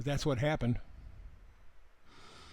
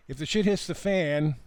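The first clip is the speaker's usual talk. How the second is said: normal talking voice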